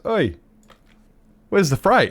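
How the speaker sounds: high-pitched retold "oi" from a customer; mock customer hailing the worker; imitated customer call-out. Imitating regular calling out on arrival.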